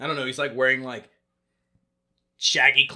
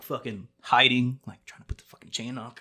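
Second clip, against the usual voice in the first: ordinary talk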